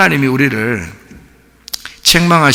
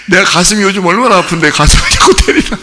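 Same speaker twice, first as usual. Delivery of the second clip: high, loud mock protest; indignant husband; 8 semitones higher, 5 dB louder than usual. Husband whose sore chest is pounded, high loud mock-indignant complaint. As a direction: complain like a husband who misses the point. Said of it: "그거를 만약에 모르고 남편이".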